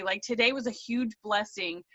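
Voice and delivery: usual speaking voice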